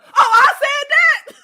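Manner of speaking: high, loud, slow disbelief; loud high amazed gasp of a line; shocked realization. Real, not acted. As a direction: cry out in surprise at your own words. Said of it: "I don't even remember that".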